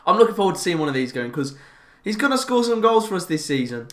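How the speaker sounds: normal talking voice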